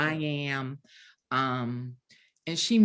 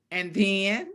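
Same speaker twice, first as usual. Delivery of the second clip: ordinary talk, nothing marked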